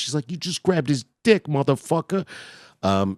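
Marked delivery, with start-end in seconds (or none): 0.3-2.2 high, loud outraged quote; mock outraged woman. Woman calling out a man, high mock outrage. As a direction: exclaim it high and loud, imitating her shock.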